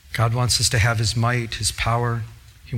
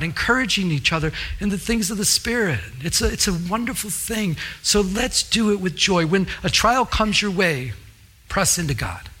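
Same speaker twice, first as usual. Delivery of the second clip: ordinary talk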